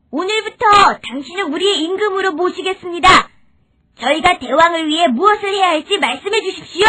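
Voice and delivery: high-pitched, earnest pledge to a king; humble forest creatures swearing loyalty; submissive animal subjects. Acted.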